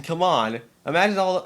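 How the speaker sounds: ordinary talk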